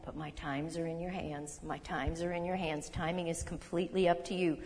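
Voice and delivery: hushed personal prayer to God; quiet sincere prayer. Real, not acted. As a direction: pray it quietly to yourself. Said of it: "This got me through a lot".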